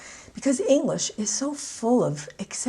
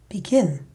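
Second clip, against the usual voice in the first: "Begin" as be-GIN; stress on second syllable; 4 dB louder than usual. Shown as a demonstration. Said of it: "stress two-syllable verbs on the second syllable".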